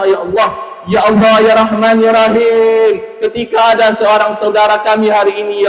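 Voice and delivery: pleading prayer that swells on each ya Allah; fervent supplication. Real, not acted. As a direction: implore God earnestly for the congregation. Fervent Malay supplication for parents. (doa penuh rayuan dan harapan)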